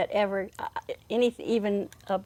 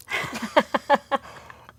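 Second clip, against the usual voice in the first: laugh; 6 semitones higher than usual. Real, not acted. Laughing at rhyme removed.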